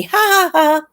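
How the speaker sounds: high, loud, fake cheery "ha ha ha"; mock happy-go-lucky laugh. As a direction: sing out a sarcastically chipper laugh. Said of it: "happy go Miss Charms a lot".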